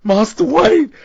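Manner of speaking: high, loud hammy butler voice; one last loud mock-butler call; hammed-up Michael Caine. Michael Caine impression skit.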